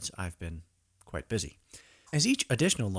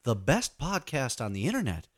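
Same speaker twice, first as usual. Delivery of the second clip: mock talk show host; host a silly radio show. Fake radio host interview.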